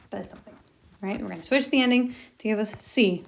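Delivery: ordinary talk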